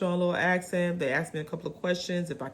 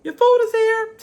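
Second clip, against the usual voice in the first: loud, much higher call across the beach; 12 dB louder, slower than usual. Retold or imitated. Beach staff calling tourists to fetch their food, loud call. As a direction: holler that the food is ready. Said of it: "They will literally be like".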